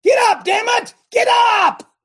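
full-force high shout; explosive rallying yell, not anger; exhorting. Shown as a demonstration. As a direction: roar them up onto their feet. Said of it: "I'm exhorting you".

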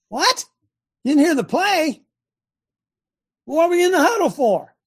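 high, loud incredulous outburst; exasperated coach berating a player; irate coach. Acted. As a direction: raise pitch and volume in disbelief.

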